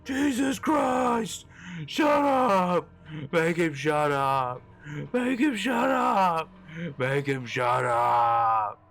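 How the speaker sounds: high, drawn-out panicked screams; terrified man screaming to silence a voice; screaming in terror. A narrator's reading. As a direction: scream like the voice is inside your head. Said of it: "started screaming".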